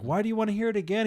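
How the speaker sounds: fast, high, exasperated question; mock annoyed. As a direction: fire it back, acting fed up.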